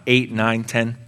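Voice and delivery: ordinary delivery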